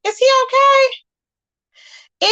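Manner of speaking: Is he okay, dragged long and high; drawn-out high mock worry. To her viewers about Suge, stretched high mock worry. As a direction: stretch it out slowly, pitched high. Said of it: "I'm like".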